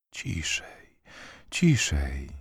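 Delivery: soft, hushed soothing; low, gentle near-whisper; tender whisper. A narrator's reading. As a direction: hush them tenderly, barely voiced at first. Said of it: "szeptał z sercem wezbranym czułością".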